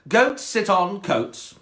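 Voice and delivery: high, very slow snobby cat. Acted. Cat schooling the frog, snobby and slow. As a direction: draw out the rule snootily. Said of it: "quite a snobby cat".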